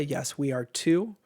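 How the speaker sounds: usual speaking voice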